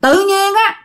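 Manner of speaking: put-on high, innocent little kid; a six-year-old blurting out to his mother, high child voice; child's voice. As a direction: pitch it up like a small child. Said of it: "nó trả lời một câu xanh dờn".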